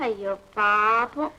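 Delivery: sarcastic sympathy toward the mother-in-law; mock pity. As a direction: drag it out mockingly.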